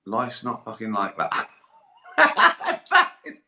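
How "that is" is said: loud, high burst of laughter; helpless laugh over the words. Real, not acted. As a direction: crack up while starting to speak. Laughing after the fable's moral.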